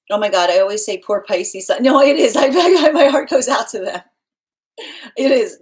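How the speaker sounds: loud, laughing burst, words breaking through laughter; cracking up while trying to talk; laughing loudly mid-sentence. Real, not acted. Laughing loudly about Pisces.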